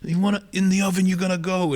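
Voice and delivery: high, heated imitation of a threat; angry threat. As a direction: play an enraged uncle threatening an intruder. Uncle threatens the intruder with oven.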